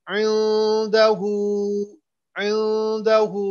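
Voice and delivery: इंदहू with the final hu stretched very slowly; long madd. Shown as a demonstration. Teacher models the madd, इंदहू drawn out long. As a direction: draw out the u of इंदहू, two counts. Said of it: "दूसरी मद भी है".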